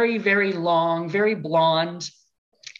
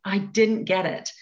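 ordinary talk, nothing marked

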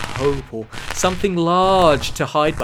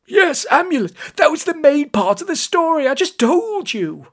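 high, loud, exasperated scolding; peeved; 13 semitones higher, 5 dB louder than usual. A narrator's reading. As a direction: snap at someone who wasn't listening. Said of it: "Prescar was quite peeved".

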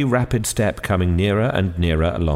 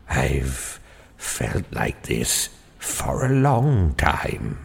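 put-on low, slow fading old voice; lower, slow feeble old voice trailing off; feeble old man; 7 semitones lower, 4 dB quieter, much slower than usual. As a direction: let the voice fade to nothing. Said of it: "in his feeble way".

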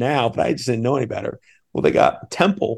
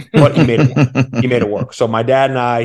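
ordinary talk, nothing marked

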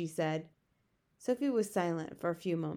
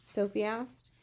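usual speaking voice

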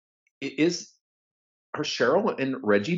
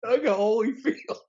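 high drawn-out; 10 semitones higher, slower than usual. Pretend. High slow echo.

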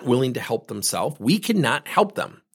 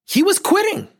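mock high squeal; 3 dB louder than usual. Mocking quitter's claim.

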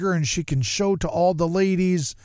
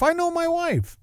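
"I know my wife" said much higher, I stretched high; exaggerated high emphasis; 14 semitones higher than usual. Shown as a demonstration. Telling the listeners he should have known his wife, high-pitched. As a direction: squeak it up high in mock exasperation.

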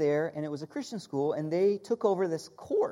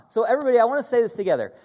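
ordinary delivery

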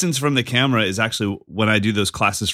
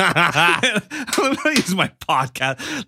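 high, drawn-out denial through laughter; slow, hammed-up podcast delivery, laughing; mock podcast voice; 9 semitones higher, much slower than usual. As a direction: deny it in an exaggerated put-on presenter voice. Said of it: "Why are you using your podcast voice?".

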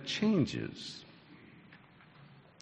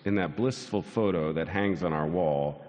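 ordinary talk, nothing marked

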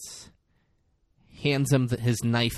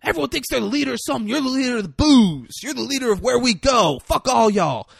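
high, loud acted outburst; worked-up, shouting mock tirade; angry rant; 11 semitones higher, 6 dB louder, faster than usual. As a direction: rail at the whole group, pitched way up. Acted rant at self-styled leaders.

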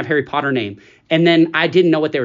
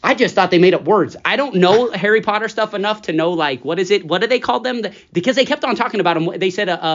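normal talking voice

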